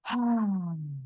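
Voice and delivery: audible breath-out sigh; exhale sigh. Shown as a demonstration. Coach leading a breathing round, audible exhale sigh. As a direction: sigh the air out. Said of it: "And let it all out".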